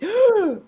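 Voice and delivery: a sharp panicked gasp for air. Retold or imitated. Retelling her panic at the Kaaba, sharp gasp. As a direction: gasp in like you can't get your breath. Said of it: "So I'm like".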